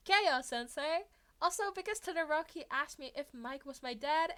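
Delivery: cheeky reply. Acted. Izuku cheeky answer.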